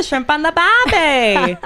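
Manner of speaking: slow, cheesy Australian cliché, "barbie" drawn out; terrible Australian accent. Acted. Host hamming up a cliché in a terrible Australian accent. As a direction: ham up the Australian tourist slogan. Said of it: "terrible Australian accents".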